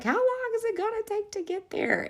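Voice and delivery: put-on high, impatient child voice on the bus; whiny kid. Teacher imitates kids whining on the bus, high voice. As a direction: mimic a restless kid asking.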